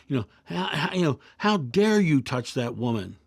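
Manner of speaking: put-on a young monk's furious disbelief at his teacher; retold angry rebuke. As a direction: play the outraged student scolding his master. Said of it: "the student was getting angrier and angrier and madder and madder".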